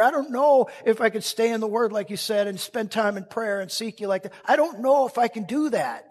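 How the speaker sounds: loud, high, pleading cry to God; a struggling believer cries out to God, loud and anguished; desperate prayer. Acted. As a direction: pour out doubt to God.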